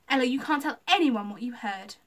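loud, urgent warning; shouted. A narrator's reading. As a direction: shout it to silence a little kid. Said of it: "Lucy shouted".

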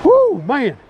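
high, loud woo of triumph; real whoop. Real, not acted. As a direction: let out a victory whoop. Pumped after measuring fish.

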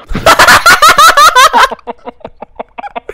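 high, loud cackling fit; real shrieking laughter. Real, not acted. Cracking up after a friend is shot, loud shrieking laughter. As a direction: burst out laughing uncontrollably.

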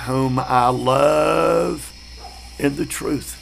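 drawn-out, deliberate verse reading; slow scripture reading. Shown as a demonstration. Preacher to class, reading scripture slowly. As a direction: read the verse slowly and reverently.

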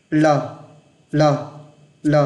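L tapped with the tongue tip. Shown as a demonstration. Teacher demonstrating the Vietnamese L, tongue tapped. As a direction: flick the tongue tip off the upper teeth. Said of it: "các bạn đập ra".

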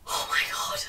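breathless whisper of dismay; whispered oh my god. Real, not acted. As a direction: whisper it, stunned. Reacting to the plot.

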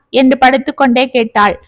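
usual speaking voice

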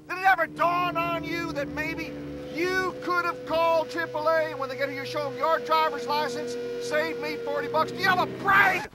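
high, loud furious rant; angry shouting. Acted. Fiancé berating his bride for not calling triple A, shouting. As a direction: let him have it, shouting. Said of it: "a man who yells at me".

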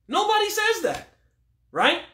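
a high, incredulous call of nobody; emphatic disbelief. Real, not acted. Rejecting an imagined objection.

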